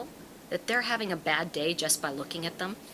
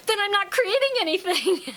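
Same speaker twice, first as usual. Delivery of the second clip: very high, loud mock panic; horrified; 12 semitones higher, 6 dB louder than usual. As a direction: imitate a student wailing in alarm. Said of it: "she was horrified".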